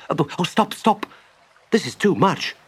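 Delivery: high-pitched ecstatic protest; overwhelmed delight. Acted. Mole hearing the picnic list, overwhelmed. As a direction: beg him to stop, thrilled.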